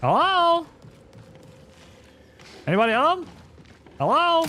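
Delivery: high, loud call into the building; calling down a hallway. Real, not acted. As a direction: call out to find someone. Calling out to a stranger in-game.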